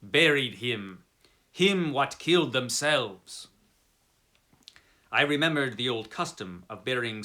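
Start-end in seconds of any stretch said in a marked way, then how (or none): 0.0-3.5 put-on slow, broken English with a light German accent; halting German-accented English. Coachman explaining the grave, slow German accent. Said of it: "said first in German, then in English".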